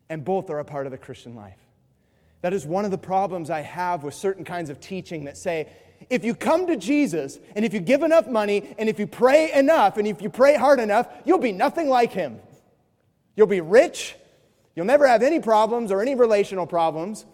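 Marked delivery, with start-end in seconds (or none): 6.1-17.3 mock prosperity preacher. Mimicking prosperity teaching.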